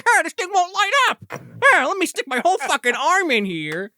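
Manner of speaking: high, dopey careless voice; dopey neighbor. Retold or imitated. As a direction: act the oblivious guy at the grill.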